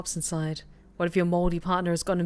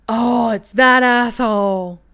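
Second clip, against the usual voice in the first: slow, drawn-out and much louder groan of disgust; annoyed recognition; 4 semitones higher, 10 dB louder, much slower than usual. Real, not acted. Streamer spotting the cop who raided their apartment, slow loud disgust. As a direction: drag it out like you can't stand him.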